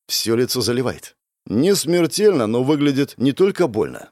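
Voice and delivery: usual speaking voice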